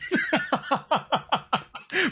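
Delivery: high rising giggle fit; high laugh. Real, not acted. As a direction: laugh high at your own joke. Streamer laughs at own bit.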